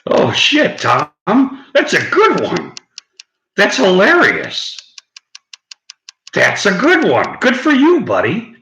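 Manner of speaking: high and loud, delighted at the joke; excited praise. Real, not acted. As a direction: cheer the viewer on, thrilled. Cracking up at viewer's pun.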